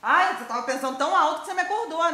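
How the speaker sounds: loud, high, mocking retort; sarcastic scolding. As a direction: throw the excuse back at her loudly. Mother throws the excuse back.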